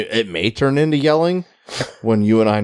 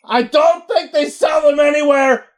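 mock yell; slower than usual. Yeller in the theater chatting about candy, shouting. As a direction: holler it slow and much higher. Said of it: "yell like a fucking idiot".

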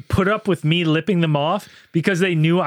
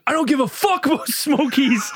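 high-pitched mock angry retort; acted angry outburst; 8 semitones higher than usual. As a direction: play an irate stranger brushing it off. Retelling the stranger's angry outburst.

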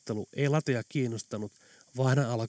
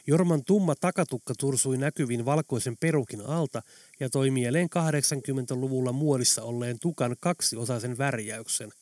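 usual speaking voice

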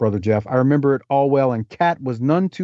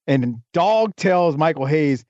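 normal talking voice

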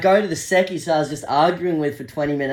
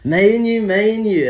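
higher and louder, matey chant; bro-hug tone, pitched up; higher drunk mate voice; 5 semitones higher, 5 dB louder than usual. Retold or imitated. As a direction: drunk bloke bonding, higher.

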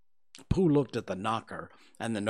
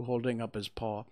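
plain reading voice

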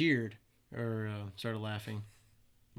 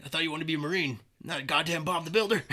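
higher, louder mock rebuke; scolding drill instructor; 6 semitones higher, 5 dB louder, faster than usual. Drill instructor mocking a recruit's broom repair, imitated loud scolding. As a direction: chew out the recruit for comic effect.

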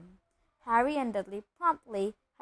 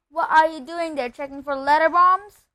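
loud, raised jeering call; higher, booming taunt; Uncle Vernon shouting; 5 semitones higher, 11 dB louder than usual. A narrator's reading. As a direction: shout the question through from another room. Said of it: "shouted Uncle Vernon from the kitchen".

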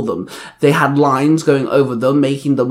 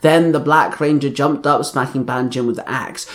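ordinary delivery, nothing marked

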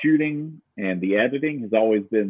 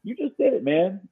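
put-on kid's voice; 13 semitones higher than usual. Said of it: "he's like".

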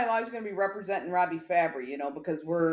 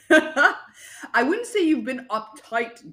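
slow words through a laugh; laughing while talking; 5 semitones higher, 4 dB louder, much slower than usual. Real, not acted. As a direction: laugh as you correct him.

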